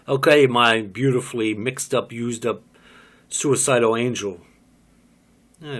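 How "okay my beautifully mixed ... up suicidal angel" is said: ordinary delivery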